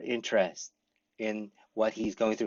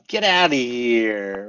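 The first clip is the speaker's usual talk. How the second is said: slow, louder mock disbelief; disbelieving get outta here; 5 dB louder, slower than usual. Shown as a demonstration. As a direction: drag it out like you can't believe it. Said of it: "You can do all kinds of things".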